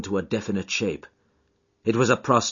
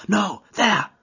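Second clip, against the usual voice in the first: high, quick-reacting exclamation; alarmed, pitched-up call; urgent correction; 11 semitones higher than usual. A narrator's reading. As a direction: redirect them urgently. Urgent correction while locating cry.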